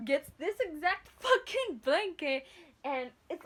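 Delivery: loud, amused, laughing through the words; real laughing. Real, not acted. Teller picturing boyfriend's blanket walk, real laughing. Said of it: "I'm fucking laughing my ass off".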